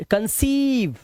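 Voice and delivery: कंसीव with a high, held ee; long vowel. Shown as a demonstration. Teacher reading an EI example, long ee in कंसीव. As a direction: stretch the ee in कंसीव. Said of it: "long vowel sound".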